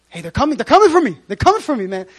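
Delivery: fast, loud and high hurried warning; mock panic. Jesus warning his disciples, mock panicked and fast. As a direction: blurt it out like they're at the door.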